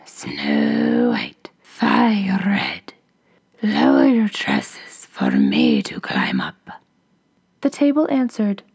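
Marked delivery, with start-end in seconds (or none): 0.0-6.7 hoarse whispering ogress call. A narrator's reading. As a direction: rasp it out like a menacing ogress. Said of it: "she called".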